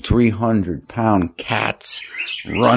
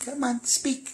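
high, soft coaxing voice; sweet-talking the cat up high; 11 semitones higher, 10 dB quieter than usual. Real, not acted. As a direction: coax the kitty to speak, way up high.

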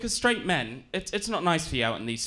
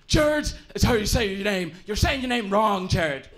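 put-on Irish accent; 3 semitones higher, 3 dB louder than usual. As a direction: imitate an Irish father correcting his son. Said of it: "my dad's Irish, so he always says".

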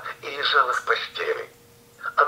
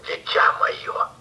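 whisper; slower than usual. Acted. Wolf in bed whispers.

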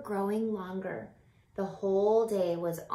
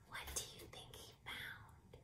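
whispered question; 21 dB quieter than usual. Real, not acted. Teacher asks the children in a hush, wonder-filled whisper. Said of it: "whispered question".

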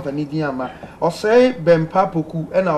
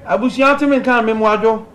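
louder and higher, calling down a bad phone line; 6 semitones higher, 5 dB louder than usual. Real, not acted. Host calling out to a caller on a bad line, loud. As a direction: raise the voice so the caller can hear.

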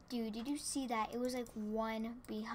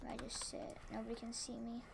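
whisper, hiding; sneaky whisper; 9 dB quieter than usual. Real, not acted. Player hiding behind the tree, whispering. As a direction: hush it like hiding from others.

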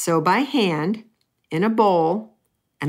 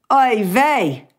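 louder Yiddish exclamation of dismay; Aunt Anna's Yiddish oy vey; 4 semitones higher, 4 dB louder than usual. Retold or imitated. Quoting great aunt over the failed cheesecake, Yiddish dismay. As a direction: throw it out like an old Yiddish aunt. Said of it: "As my great Aunt Anna would have said".

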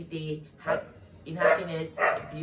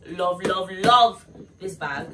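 gushing; 9 dB louder, slower than usual. Real, not acted. Gushing over a gift bag.

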